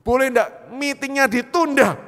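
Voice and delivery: loud, high, slow plea down the phone; urgent retold request. Man phoning his company to postpone the meeting, urgent request.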